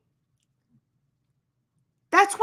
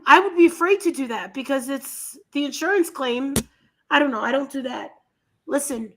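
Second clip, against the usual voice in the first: ordinary delivery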